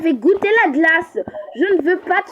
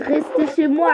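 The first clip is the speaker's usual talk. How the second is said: ordinary talk, nothing marked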